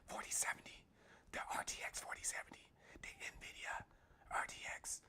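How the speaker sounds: very soft confidential whisper. Pretend. Confiding in viewers while wife is upstairs, whispering. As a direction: whisper it like a secret kept from someone upstairs. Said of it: "That's why I'm whispering".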